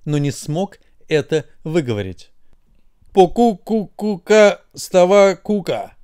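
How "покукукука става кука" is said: slow, higher gibberish mumbled half-asleep; a sleepy brain trying to say wake up; drowsy nonsense babble; sleepy mumble. A narrator's reading. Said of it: "пробормотал мозг".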